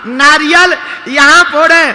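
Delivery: high-pitched shouted punchline; exuberant proclamation. Acted. Punchline repeated loudly to the crowd.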